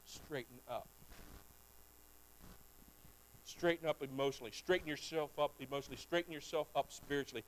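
soft pleading call to straighten up; quiet urging. Acted. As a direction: lean in and press it gently. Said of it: "quiet emphatic exhortation".

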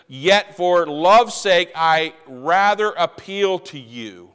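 slow, weighty scripture reading; slow emphatic reading. Shown as a demonstration. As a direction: let each word land, slowly. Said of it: "reading the key verse slowly".

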